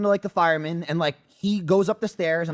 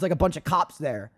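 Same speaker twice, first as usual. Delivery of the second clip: normal talking voice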